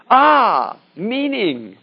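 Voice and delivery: high, swooping "Ah" of discovery; mock rapture; blissful revelation. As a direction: sigh it out high, as if enlightened. Snoopy finds meaning in dog food.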